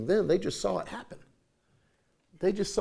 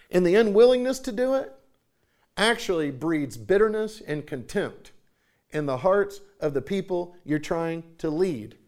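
ordinary talk

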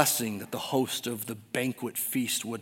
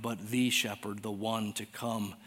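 normal talking voice

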